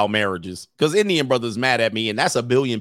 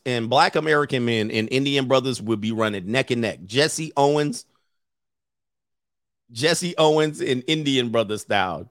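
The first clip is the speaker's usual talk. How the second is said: usual speaking voice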